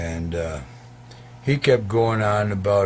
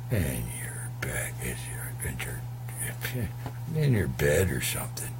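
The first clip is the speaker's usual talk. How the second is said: whispered aside; 6 semitones lower, 7 dB quieter than usual. Real, not acted.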